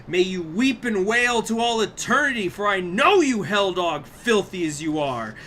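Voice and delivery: damn him to hell with relish; Dante cursing. Acted. Dante curses the filthy sinner in the river, loud and high.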